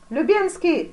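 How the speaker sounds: loud, high call to an aide; shout. A narrator's reading. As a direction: shout the name as a summons. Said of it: "закричал великий князь". (громкий окрик)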